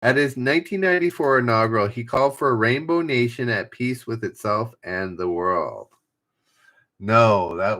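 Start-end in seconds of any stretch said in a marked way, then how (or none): 0.0-5.9 fast, rushed reread of the clue; quick reread. Shown as a demonstration. Streamer rushing through a repeated clue for chat, fast. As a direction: rattle the clue off again quickly.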